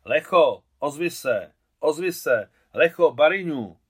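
big loud shout across the yard, falling at the end; loud calling. A narrator's reading. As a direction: call out over the wedding noise. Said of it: "je nejvíce slyšet".

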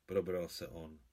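ordinary delivery

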